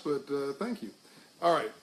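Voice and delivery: usual speaking voice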